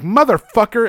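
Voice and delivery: high, loud outraged curse; outraged yell. Retold or imitated. [name] retells finding the raisin cookie, outraged yell. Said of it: "I'm like".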